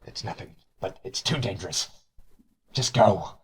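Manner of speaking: far off voice. Acted. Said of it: "kind of a far off voice say".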